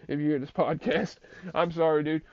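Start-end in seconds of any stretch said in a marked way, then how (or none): none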